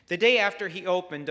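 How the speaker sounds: ordinary delivery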